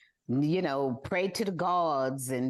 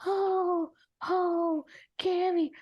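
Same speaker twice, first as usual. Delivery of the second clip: hammed-up crying, pitched high; mock crying; 13 semitones higher, slower than usual. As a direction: wail his name like a blubbering fool. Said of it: "she's crying".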